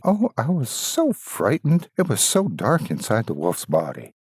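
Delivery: lightly voiced girl, high on the first cry; relieved girl. Girl freed from the wolf's belly, lightly voiced. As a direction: blurt it out shaken but free. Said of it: "jumped out and cried".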